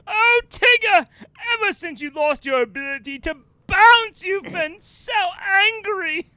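high, loud stage pleading; melodramatic actor. Acted. Actor fleeing the tiger while counselling it, overacted. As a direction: console grandly, stretching the key words. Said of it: "he'll be running away from the tiger and be like".

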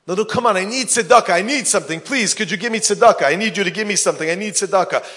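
high, loud, fast insistent begging; pleading beggar woman. Acted. Beggar woman pleading at the door, high and insistent. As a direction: press him hard for charity.

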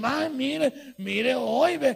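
loud, sarcastic gossip voice; mock admiration. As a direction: fake surprise that drips envy. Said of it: "le dicen".

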